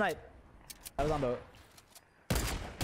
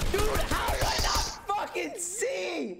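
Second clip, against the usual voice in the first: raging gamer; 8 semitones higher than usual. Real, not acted. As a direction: vent at the game. Missing an enemy, frustration.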